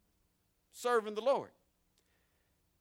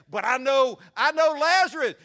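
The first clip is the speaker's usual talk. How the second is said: much louder, higher desperate plea; rich man pleading; 6 semitones higher, 11 dB louder than usual. Acted. Rich man in hell crying out that he knows Lazarus, loud. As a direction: beg as the rich man name-dropping.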